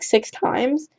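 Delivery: usual speaking voice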